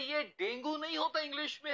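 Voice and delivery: usual speaking voice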